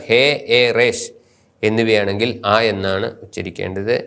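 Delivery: ordinary talk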